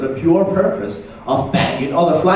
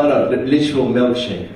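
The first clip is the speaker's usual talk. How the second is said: normal talking voice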